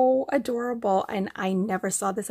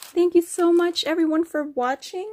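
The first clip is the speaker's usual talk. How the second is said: higher, louder cheery thanks; bright sign-off; 8 semitones higher, 5 dB louder than usual. Real, not acted. Thanking everyone for watching, higher louder cheery thanks. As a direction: beam it at the viewers. Signing off to viewers.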